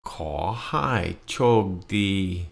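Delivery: Khaw hai chok di drawn out syllable by syllable; very slow model. Shown as a demonstration. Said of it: "Repeat after me".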